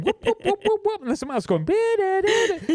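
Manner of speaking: high, laughing whoops and das; imitated whoops. Producer mimics too many instruments at once, high silly noises. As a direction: squeal out the clashing parts.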